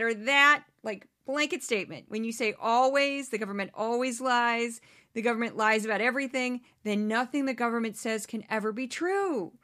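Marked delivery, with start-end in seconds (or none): none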